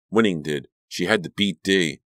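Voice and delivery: plain reading voice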